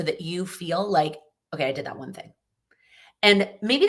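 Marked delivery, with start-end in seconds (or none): 1.5-2.3 whispered, a small private win; hushed whisper of mild relief; whispered self-talk. Retold or imitated. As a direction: breathe it to yourself like a quiet victory. Quoting own small relief.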